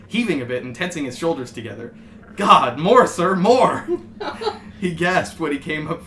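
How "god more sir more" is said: much louder, higher, laughing; hammed-up cry. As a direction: shout it out over the top. Rock cries out in scene.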